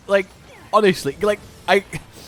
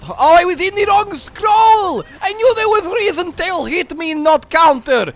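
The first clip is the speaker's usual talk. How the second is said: loud, high frustrated outburst; frustrated shout; 12 semitones higher, 6 dB louder than usual. Real, not acted. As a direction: yell in laughing frustration. Frustrated outburst at own mistake.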